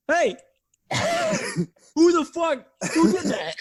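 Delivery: high-pitched, laughing outrage; comic high-voiced confrontation; mock indignant demand. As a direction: play a leader demanding the culprit, for laughs. Imagined Putin confronting his people.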